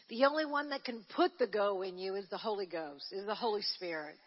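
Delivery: ordinary talk, nothing marked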